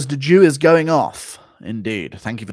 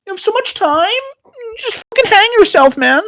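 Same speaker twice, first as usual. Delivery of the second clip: very high, loud motivational-guru impression; Gary Vee; 7 dB louder than usual. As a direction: squeal it like an over-the-top hustle coach. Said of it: "Need your best Gary Vee impersonation".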